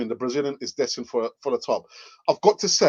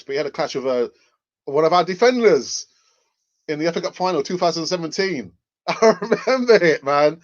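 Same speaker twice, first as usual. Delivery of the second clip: ordinary delivery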